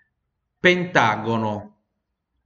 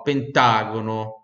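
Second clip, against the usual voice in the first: Italian accent; 2 semitones lower than usual. Shown as a demonstration. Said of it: "puxar aquele sotaque italiano do nono".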